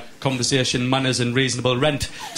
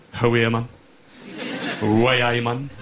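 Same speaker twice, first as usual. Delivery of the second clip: regional Sunderland accent. Shown as a demonstration. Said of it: "say certain things in my accent".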